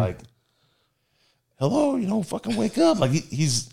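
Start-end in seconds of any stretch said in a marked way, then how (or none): 1.6-3.0 high, urgent wake-up call; waking him up. Retold or imitated. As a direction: try to rouse a passed-out friend. Trying to wake passed-out friend.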